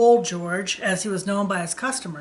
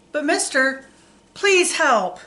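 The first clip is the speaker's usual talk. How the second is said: begging; 6 semitones higher, 4 dB louder, slower than usual. A narrator's reading. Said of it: "begged the driver".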